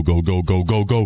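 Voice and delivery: ordinary talk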